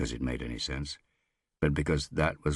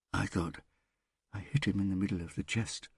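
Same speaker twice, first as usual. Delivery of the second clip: robotic whisper; 6 semitones higher, 4 dB quieter than usual. A narrator's reading. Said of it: "whispered in a metallic, robotic voice".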